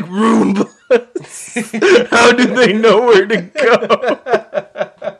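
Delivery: high, loud mock-fearful quote breaking into laughter; Batman, laughing. Fan retelling a comedy skit, laughing through the quote. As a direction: crack up while imitating the skit hero. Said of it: "I'm sorry if I'm laughing".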